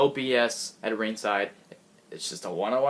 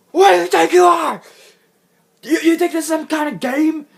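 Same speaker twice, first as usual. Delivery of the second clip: a much higher, very loud hammed-up yell at the players; furious snapping shout; 14 semitones higher, 10 dB louder than usual. Imitating the coach snapping at Goldust and R-Truth, a loud high furious yell. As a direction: blow up like an old coach who's had enough. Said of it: "he snaps".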